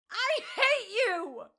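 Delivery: very high, loud, slow shriek. A narrator's reading. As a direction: shriek it with everything left. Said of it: "she shrieked".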